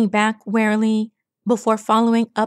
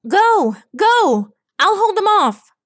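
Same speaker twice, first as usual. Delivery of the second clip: high, loud command; urgent shout; 11 semitones higher, 6 dB louder than usual. A narrator's reading. Rainbow fighting minotaurs, to the group above, urgent shout. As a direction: yell up to them urgently. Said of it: "she shouted to the group above".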